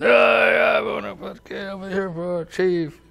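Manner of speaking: high, loud shouting nomination; rowdy shout. Retold or imitated. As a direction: holler it like a tipsy buddy. Drunk friends nominating him.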